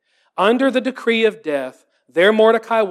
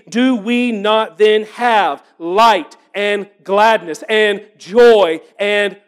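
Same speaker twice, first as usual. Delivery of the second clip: slow exultant; slower than usual. Real, not acted. Closing exultant question.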